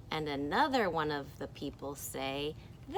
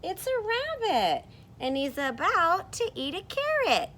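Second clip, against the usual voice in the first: high, loud eager character voice; 13 semitones higher, 6 dB louder than usual. Acted. Teacher voicing rabbit-seer answering, eager. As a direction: announce the carrot with delight. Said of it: "And this person says".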